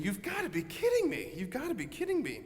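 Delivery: very high, comic incredulous voice; high squeaky can't-believe-it voice; exasperated disbelief. Retold or imitated. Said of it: "And Peter's thinking".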